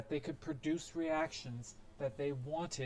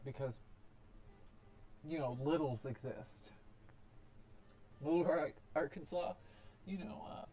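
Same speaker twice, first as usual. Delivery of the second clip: usual speaking voice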